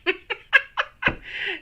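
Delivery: high bright burst of laughter; real laugh. Real, not acted. Tickled by her own switch to French, laughing. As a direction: laugh out loud at yourself.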